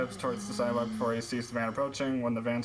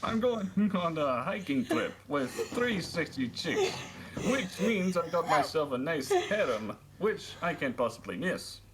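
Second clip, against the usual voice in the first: higher-pitched mock British teenager; hammed-up British voice; put-on British accent; 6 semitones higher than usual. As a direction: play a British character, half laughing. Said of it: "we went from America to Britain".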